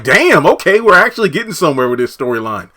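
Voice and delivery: thrilled it's going somewhere; eager, fast approval; excited. Real, not acted. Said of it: "I'm like".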